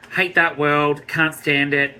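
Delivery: ordinary talk, nothing marked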